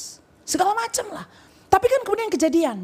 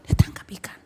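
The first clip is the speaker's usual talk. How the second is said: hushed awed whisper; a whispered aside to the congregation; faster than usual. Acted. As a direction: breathe it like a secret. Awed aside about the catch.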